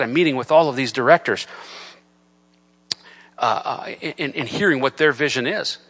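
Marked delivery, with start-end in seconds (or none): none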